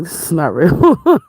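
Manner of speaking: slow, drawn-out disbelief at the roommate. Retold or imitated. To the roommate pushing her stuff over, slow disbelief. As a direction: stretch it out like you can't believe it. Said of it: "I'm like".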